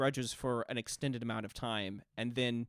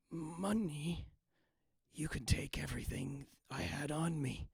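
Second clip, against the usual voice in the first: hollow, softer ghost voice; 4 semitones higher, 5 dB quieter, slower than usual. Acted. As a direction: speak like a spirit in a borrowed body. Ghost speaks through merchant's body.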